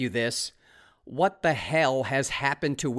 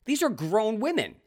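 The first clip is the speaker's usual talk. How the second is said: ordinary delivery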